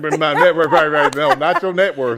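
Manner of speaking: loud, high insistence on net worth; emphatic correction. Real, not acted.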